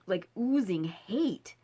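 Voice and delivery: usual speaking voice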